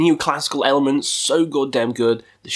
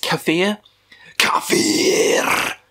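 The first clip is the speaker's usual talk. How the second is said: harsh whispered scream-along; screamed lyric; 3 semitones higher, slower than usual. Retold or imitated. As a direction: rasp out the lyric like the singer. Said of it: "When he screams that".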